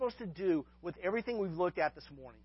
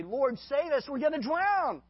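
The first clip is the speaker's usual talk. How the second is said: crying out; 8 semitones higher, 6 dB louder, slower than usual. Retold or imitated. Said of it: "the disciples cry out".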